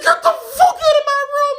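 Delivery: high, far-pitched furious scream; screaming teacher. Retold or imitated. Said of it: "he starts screaming".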